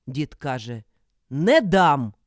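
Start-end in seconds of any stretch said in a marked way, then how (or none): 1.3-2.1 loud, emphatic outburst; loud refusal. A narrator's reading. Old man won't give up his wife, loudly refusing. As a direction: refuse with full force.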